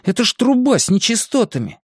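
high-pitched disgusted protest; protest, revolted by the very idea. A narrator's reading. Boy objects to crawling into sewage, disgusted. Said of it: "поморщился Стэн".

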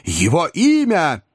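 high, slow, drawn-out cry; shouted demand. A narrator's reading. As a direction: demand it, stretching each word high. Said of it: "крикнул Бен Эллен".

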